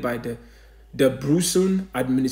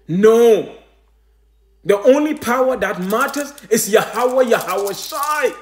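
high, loud preacher's cry; fiery preaching; 6 semitones higher, 4 dB louder than usual. Acted. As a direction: exalt the name, preach it out. Preaching at full force.

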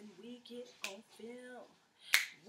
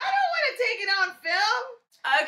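much higher and louder, protesting; 11 semitones higher, 15 dB louder than usual. Real, not acted. Friend refusing to be filmed during their fight, shouting.

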